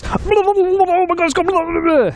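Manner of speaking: high, slow whiny chat imitation; mocking chat voice. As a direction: whine it high and drawn out like a panicked viewer. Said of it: "all I'm gonna see in the chat is".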